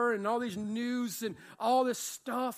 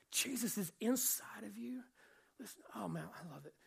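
hushed, breathy whisper to the congregation; 7 dB quieter than usual. Real, not acted. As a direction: lean in and whisper it like a secret. Preacher drops to a confiding whisper.

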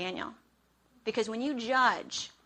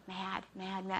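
seething mutter; 2 semitones lower, 7 dB quieter, slower than usual. Retold or imitated. Muttering while seething, retold.

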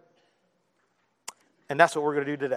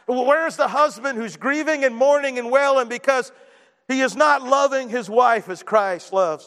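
raised, rising pulpit cry; impassioned preacher, pitched up and pushing; preaching at full force; 6 semitones higher than usual. Acted. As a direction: pile on the questions, voice climbing high.

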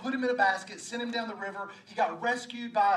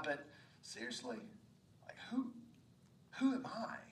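hushed, halting self-doubt; insecure; 10 dB quieter than usual. Acted. Moses questioning God's choice of him, hesitant and insecure. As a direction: falter softly, pausing before the question. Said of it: "Can you hear the insecurity in Moses' voice?".

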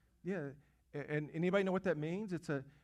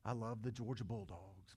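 casual throwaway; 5 semitones lower, 9 dB quieter than usual. Retold or imitated. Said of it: "we throw that word around".

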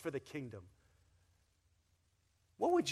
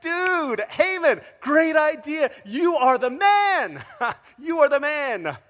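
much higher and louder, slow and gushing; overjoyed king; 12 semitones higher, 17 dB louder, slower than usual. Retold or imitated. Preacher voicing Xerxes thrilled with Haman's idea, gushing. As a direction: gush with delight. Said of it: "Xerxes is like".

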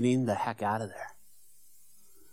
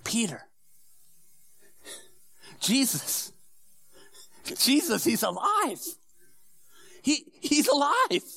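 calling out louder and higher, building with each word; retold scream; 12 semitones higher, 4 dB louder than usual. Brother Andrew calls to Peter, building excited cry. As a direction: call out the news, rising in excitement.

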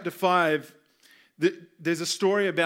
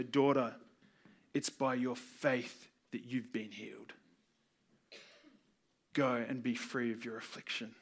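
soft, low, tender voice for Jesus; gentle Jesus; 6 semitones lower, 8 dB quieter than usual. Acted. Jesus gently tells the healed woman she is free, soft and tender. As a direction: speak to her kindly and softly.

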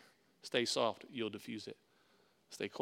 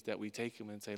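usual speaking voice

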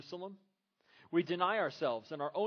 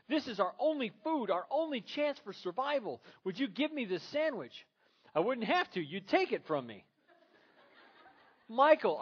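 louder, higher exasperated wife; Gloria's demand; 6 semitones higher, 5 dB louder than usual. Retold or imitated. Wife demanding her husband's love, loud and high. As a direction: push him hard for the words.

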